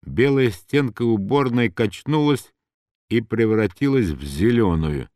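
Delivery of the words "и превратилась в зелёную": ordinary delivery, nothing marked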